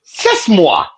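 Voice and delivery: put-on Italian-style Mario accent; a quick Mario-like Italian accent. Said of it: "why'd you sound like Mario for a second".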